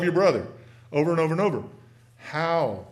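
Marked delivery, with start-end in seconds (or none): none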